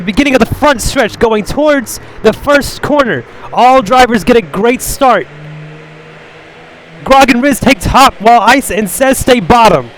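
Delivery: high, loud race commentary; excited play-by-play. Acted. Commentator calling the opening lap to viewers, excited. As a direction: call the race at full excitement.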